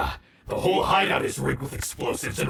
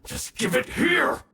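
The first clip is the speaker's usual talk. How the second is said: angry demand; 11 semitones higher than usual. Acted. Villain demands charm.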